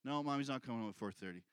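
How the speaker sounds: low, fast answer; father answering his son, low and fast; quick parental reply. Retold or imitated. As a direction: brush it off quickly. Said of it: "father's reply".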